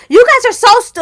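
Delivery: high, loud cut-off scold; scolding mom. Retold or imitated. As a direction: start to chew them out. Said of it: "she's talking shit to us".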